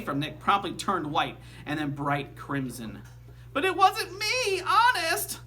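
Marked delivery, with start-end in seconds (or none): 3.5-5.4 put-on high, pleading little girl voice; near tears. A narrator's reading. Girl wrongly accused by teacher, high and tearful. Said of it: "a catch in Janet's voice as if she might cry".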